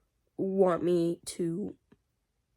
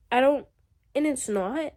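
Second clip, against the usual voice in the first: ordinary talk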